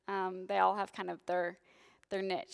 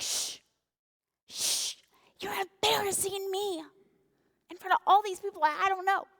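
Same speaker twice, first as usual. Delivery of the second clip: put-on high, strained hush at a noisy kid; embarrassed parent; 10 semitones higher than usual. Parent hushing kids at grocery store, high mock panic. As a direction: shush your kid in the store, mortified. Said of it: "And you're like".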